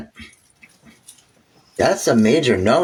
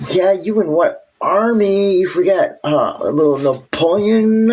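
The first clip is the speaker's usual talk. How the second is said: mock taunt; 6 semitones higher than usual. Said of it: "The U S is like".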